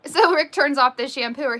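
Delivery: normal talking voice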